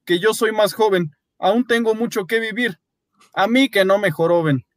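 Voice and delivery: high whiny excuse; Paolo, selfish. A narrator's reading. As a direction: whine to save yourself. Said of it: "dijo Paolo".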